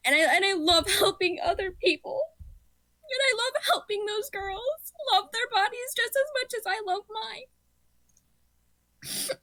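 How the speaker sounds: shaky voice, high and steadying. Real, not acted. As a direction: pull the trembling voice together. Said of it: "I'm getting emotional".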